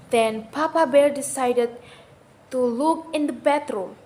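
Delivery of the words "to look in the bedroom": plain reading voice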